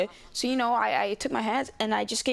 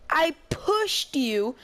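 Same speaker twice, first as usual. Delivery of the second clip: higher, louder, slow disbelieving protest; slow, pleading disbelief; slow incredulous; 5 semitones higher, 3 dB louder, much slower than usual. Retold or imitated. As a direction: stretch it out like it's absurd. Repeats the reply in disbelief.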